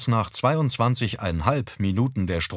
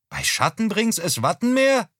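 high, loud puzzled call from afar; mother calling; 11 semitones higher, 3 dB louder than usual. Acted. Mother mishears her husband from the kitchen, high puzzled call. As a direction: call back baffled in a much higher voice.

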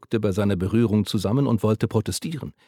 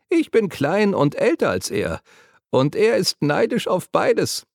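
put-on high, teasing old woman's voice; giggling old woman; 10 semitones higher, 4 dB louder than usual. A narrator's reading. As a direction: tease him like a cheeky old lady. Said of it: "kicherte die Alte". (kichernde Alte, hohe Stimme)

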